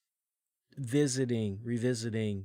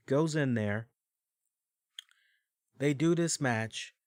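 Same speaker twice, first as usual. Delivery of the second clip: normal talking voice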